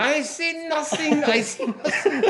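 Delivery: Sergeant Schultz. Retold or imitated. Schultz denying everything, slow comic German voice. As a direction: play the bumbling guard who saw nothing. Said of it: "You got Sergeant Schultz".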